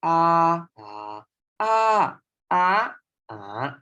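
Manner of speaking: "Ah, ah, ah, ah, ah" in five Thai tones, each pitched differently. Shown as a demonstration. Teacher demonstrating five Thai tones to students, clearly contrasted. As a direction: run through the five Thai tone shapes on ah. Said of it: "Thai have five tone".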